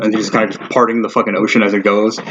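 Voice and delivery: ordinary delivery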